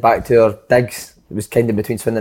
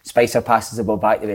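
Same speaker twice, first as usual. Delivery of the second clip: normal talking voice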